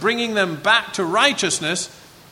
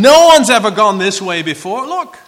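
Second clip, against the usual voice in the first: mocking shout; 9 dB louder than usual. Mocking Hezekiah's words.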